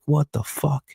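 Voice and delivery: stunned, whispered aside; shocked whisper. Retold or imitated. Jumpin' Joey stunned his victim survived, whispering. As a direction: whisper it in disbelief. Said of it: "He's fucking snapping".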